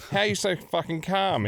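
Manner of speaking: high, mild mock disbelief; alarmed doctor. Doctor asking the patient, a touch incredulous. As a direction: lightly voice a baffled doctor. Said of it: "he says".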